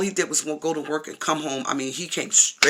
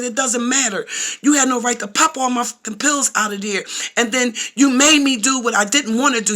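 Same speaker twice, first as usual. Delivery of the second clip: higher-pitched retold argument; heated accusation; 8 semitones higher than usual. Woman retelling a phone quarrel with her boyfriend, accusing. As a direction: re-enact laying blame, voice raised in pitch.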